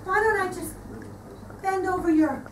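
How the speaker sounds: ordinary talk, nothing marked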